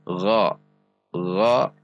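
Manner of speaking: "gha" as a voiced back-of-throat rub; correct ghain. Shown as a demonstration. Teaching learners the makhraj of ghain, voiced gha. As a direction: voice the GH of "gha", heavy and rounded. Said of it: "perhatikan sifat dan makhrajnya".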